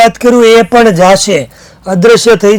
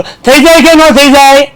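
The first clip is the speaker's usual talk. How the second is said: much higher and louder, calling the class to answer; 8 semitones higher, 4 dB louder, slower than usual. Retold or imitated. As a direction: shout the question to the room. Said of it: "જોરથી બોલ".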